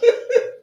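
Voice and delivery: sudden high squeal of a laugh; real laughter. Real, not acted. Host hearing the David Delco nickname bursts out laughing, high and loud.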